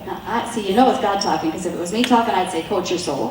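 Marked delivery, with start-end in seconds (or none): 2.6-3.3 lower, dropped-off coach line; preacher imitating her own blunter phrasing; her own blunt wording. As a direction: say it like a coach would. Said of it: "that's how I talk".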